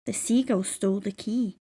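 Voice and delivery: ordinary delivery, nothing marked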